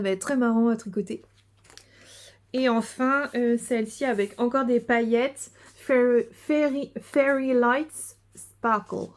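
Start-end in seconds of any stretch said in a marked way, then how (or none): 5.7-9.1 "Fairy Lights Sparkle" drawn out slowly in a hammed-up English accent; mock English accent. Shown as a demonstration. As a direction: show off a playful put-on English accent. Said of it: "mon superbe accent". (faux accent anglais exagéré et lent)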